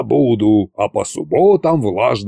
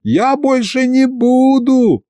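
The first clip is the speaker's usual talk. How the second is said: high, drawn-out panicky plea; pleading; 3 dB louder, slower than usual. A narrator's reading. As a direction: beg shrilly for mercy, stretching the words. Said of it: "хором взмолились головы".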